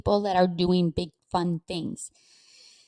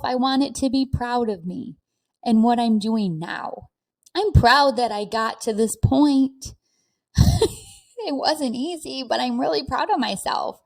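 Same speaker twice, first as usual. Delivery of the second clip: normal talking voice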